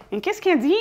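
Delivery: put-on a light, lifted take on a French listener puzzled by her accent; lightly voiced baffled French viewer; mock French listener. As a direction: tease yourself in a listener's baffled voice. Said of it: "vraiment rire de mon accent".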